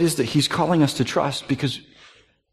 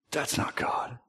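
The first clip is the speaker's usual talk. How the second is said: whispered; 10 dB quieter, slower than usual. Real, not acted. Rejecting the scolding God.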